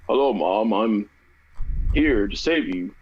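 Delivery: put-on low, unbothered adult voice for a child's line; don't change your voice for the kid; low grown-up monotone, mock bad dubbing; unchanged grown man voice. Shown as a demonstration. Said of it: "like a grown man in your normal voice".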